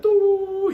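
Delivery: high, steady ringing tone; a long piercing ring in the ears; imitated tinnitus ring. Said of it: "that tone, like, that tinnitus sound".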